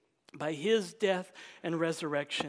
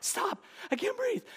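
mock laughing plea. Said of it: "you're just like".

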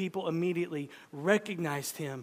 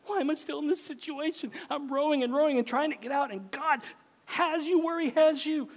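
frustrated believer; 8 semitones higher, 7 dB louder than usual. Retold or imitated. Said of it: "and we wonder why".

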